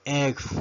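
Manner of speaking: letter F pronounced "ef"; English letter F. Shown as a demonstration. Teacher modelling the English alphabet, F as "ef". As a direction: say F as one-syllable "ef". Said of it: "La letra F".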